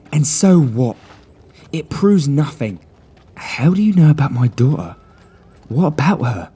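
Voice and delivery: a father alarmed at mention of his daughter; shaken gentleman. Acted. The man voiced.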